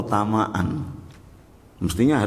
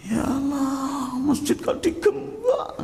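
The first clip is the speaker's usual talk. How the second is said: high, slow half-laughing sob; a worshipper crying that the mosque is locked, sobbing high; mock sobbing; slower than usual. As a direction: weep over the padlocked mosque. Acts weeping over locked mosque.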